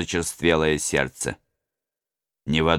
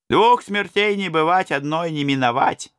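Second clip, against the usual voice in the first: bold robber; 11 semitones higher than usual. Acted. Robber to his comrades, high reckless voice. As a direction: toss out the proverb like a daredevil.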